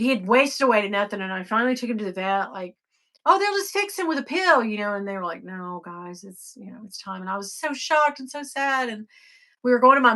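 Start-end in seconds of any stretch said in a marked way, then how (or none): 3.3-4.6 retold naive optimism. Retells own naive hope at vet. 5.4-7.2 soft, lowered voice of a vet; gentle bad news. Vets gently break bad news.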